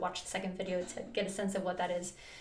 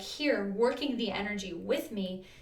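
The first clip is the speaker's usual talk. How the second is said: usual speaking voice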